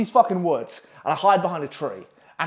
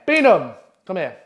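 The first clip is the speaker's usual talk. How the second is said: acted summons, louder on the name; calling out; 2 semitones higher, 6 dB louder than usual. House staffer calls pupil across the pitch, calling out. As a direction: call a pupil over, imitating the house staffer. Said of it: "he calls my name".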